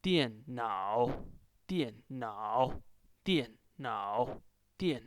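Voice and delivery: slow tone drill on "Dian nao"; fourth then third tone. Shown as a demonstration. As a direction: drop on "Dian", scoop up through "nao". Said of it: "First is the fourth tone".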